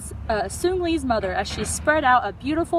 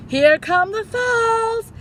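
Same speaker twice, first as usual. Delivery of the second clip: high, slow, drawn-out cry; excited call; 11 semitones higher, 5 dB louder, much slower than usual. Acted. Kid calling out as the canoe reaches the waterfall. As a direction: build up the thrill.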